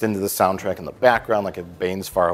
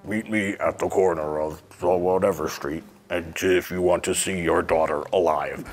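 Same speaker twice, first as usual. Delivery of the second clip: voice changer prank call; 3 semitones lower than usual. Retold or imitated. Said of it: "a prank phone call voice".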